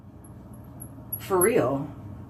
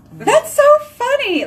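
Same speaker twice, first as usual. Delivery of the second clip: much higher, slow; delighted squeal; 3 dB louder, slower than usual. Retold or imitated. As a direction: gush with delight. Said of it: "And I'm like".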